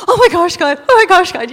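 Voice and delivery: loud, high mock panic; hammed-up horrified gasping prayer; freaking out. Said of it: "Here's Pam freaking out".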